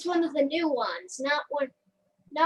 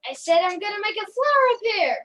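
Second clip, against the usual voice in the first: louder insistent command; raised, forceful mock exasperation; frustrated magician; 4 semitones higher, 6 dB louder than usual. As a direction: repeat the spell at a stubborn wand. Magician insisting as trick fails.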